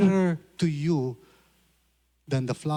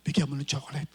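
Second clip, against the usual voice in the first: voice of God; 5 semitones lower, 3 dB quieter, faster than usual. Retold or imitated. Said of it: "It is the Lord saying".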